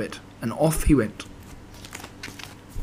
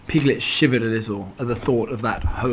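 ordinary delivery

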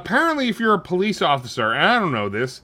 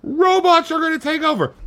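grito agudo de pregador de rua, imitado; louco gritando na rua; 11 semitones higher than usual. Retold or imitated. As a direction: imita um maluco da Times Square berrando o fim do mundo. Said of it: "There's always somebody screaming".

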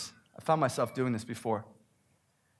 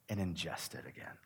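whisper; 7 semitones lower, 12 dB quieter than usual. Acted. Confessing habit, drops to whisper.

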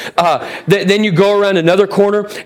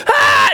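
very high mock shriek of terror; imitated scream. [name] mimicking Katie fleeing the chainsaw, high-pitched scream. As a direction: squeal in mock panic, not at full volume. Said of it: "screaming at the top of her lungs".

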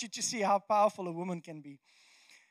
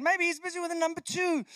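higher-pitched teasing jibe; mocking taunt; 6 semitones higher than usual. Elijah's joke about the prophets' god, mocking. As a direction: poke fun at their god. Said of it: "like, mocking them".

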